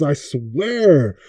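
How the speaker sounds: ordinary delivery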